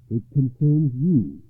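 loud, slow, deliberate vow; resolute, measured declaration; solemn knight. A narrator's reading. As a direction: pledge it slowly in a full voice. Knight solemnly yields the choice.

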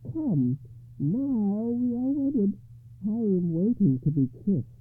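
high, loud, slow summons; imperious high-pitched hag lady; monstrous bride, expectant. A narrator's reading.

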